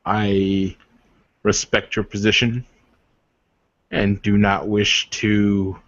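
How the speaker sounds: a hint of a formal, courtly warrior; lightly voiced noble warrior. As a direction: speak as a proud suitor, measured and earnest. GM voices NPC suitor.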